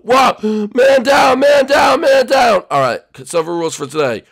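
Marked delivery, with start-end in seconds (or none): 0.0-2.6 loud, high battlefield shout; man-down alarm. Acted. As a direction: yell it high, like a soldier raising the alarm. Acted alarm, comrade hit.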